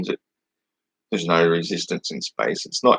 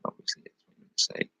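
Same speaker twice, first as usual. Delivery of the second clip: muttered aside; 10 dB quieter than usual. Real, not acted. Snide aside while reading the comment.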